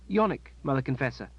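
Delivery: put-on a respectful boy answering; young boy voice. A narrator's reading. Boy voiced in read story.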